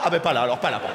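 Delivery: very low, resigned mutter; comic backing off; deep deflated mumble. Acted. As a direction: drop it low and give up.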